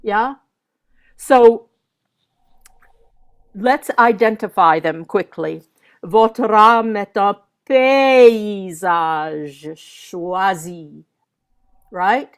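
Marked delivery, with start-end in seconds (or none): 6.0-11.0 paysage with its opening "pay" swelled and held; slow French line, lean hard on the start of paysage; insistance on first syllable. Shown as a demonstration. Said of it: "So I need to hear".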